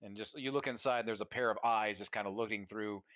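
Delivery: usual speaking voice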